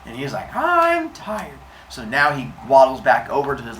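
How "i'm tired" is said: put-on high, whiny little-kid voice; pitched-up childish complaint; tired baby. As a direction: play a worn-out toddler. Baby worn out after sacrifice.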